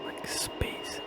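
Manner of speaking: soft whispered aside; hushed, whispered interjection. Real, not acted. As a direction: whisper it low, all breath. Awed aside describing the purple glow.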